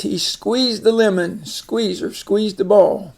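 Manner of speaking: normal talking voice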